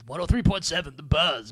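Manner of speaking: usual speaking voice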